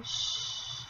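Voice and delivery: unvoiced hush; soothing shush. A narrator's reading. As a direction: hush a child to calm. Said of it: "rocking her and saying".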